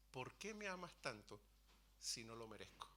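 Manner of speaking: a soft, hushed question, almost a whisper; hushed tender plea. Retold or imitated. A man in his dream asks God softly, tender and hushed. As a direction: ask it quietly, overwhelmed by love. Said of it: "Y yo digo en el sueño".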